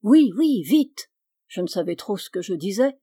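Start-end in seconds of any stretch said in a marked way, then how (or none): 0.0-1.1 louder, higher, urgent yes; pressé. A narrator's reading. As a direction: urge the child on impatiently. Eager urging in narrated dialogue. (pressant, impatient)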